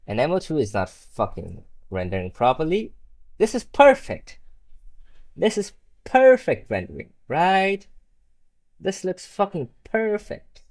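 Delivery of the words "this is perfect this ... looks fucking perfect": high, loud mock delight; sarcastic praise. Real, not acted. Gamer mocking his glitching mod render, sarcastic.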